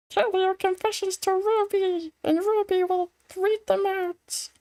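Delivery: high squeaky mascot announcement; squeaky cartoon voice.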